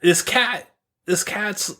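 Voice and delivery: normal talking voice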